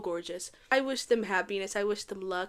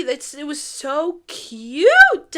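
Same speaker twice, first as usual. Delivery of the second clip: gushing; 4 semitones higher, 10 dB louder, much slower than usual. Real, not acted. Gushing over couple.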